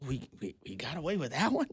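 a quiet smug plotter, lightly voiced; mock scheming politician. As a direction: whisper it like a conspirator.

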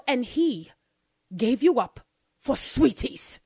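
a lightly voiced cold witch, slow. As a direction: drawl it out like a sly queen. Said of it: "She goes".

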